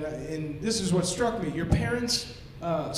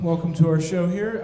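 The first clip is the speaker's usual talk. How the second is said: normal talking voice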